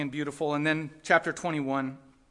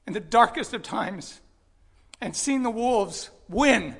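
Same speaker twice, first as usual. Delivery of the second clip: slow, louder, higher preaching, words drawn out; impassioned preaching; 4 semitones higher, 7 dB louder, slower than usual. Real, not acted. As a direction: preach it slowly with a heavy heart, full force. Impassioned preaching on lost flock.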